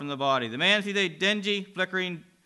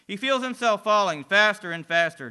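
ordinary delivery

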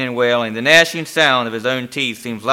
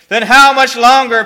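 high, loud, desperate question; despairing demand; 11 semitones higher, 10 dB louder than usual. A narrator's reading. Damned man asking the demon, despairing. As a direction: cry it out in utter despair. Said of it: "pants the man in final utter despair".

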